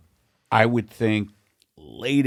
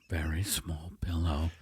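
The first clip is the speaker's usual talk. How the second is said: whispering; 5 semitones lower, 9 dB quieter than usual. Acted. Whispered laughing aside.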